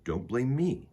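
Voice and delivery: put-on gentle read-aloud to children; storybook reading. Reads picture book aloud.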